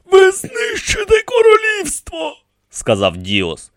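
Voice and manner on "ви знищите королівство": very high protesting voice; alarmed protest. A narrator's reading. High priest warns the rebels, protesting. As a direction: warn them urgently in a thin, high register.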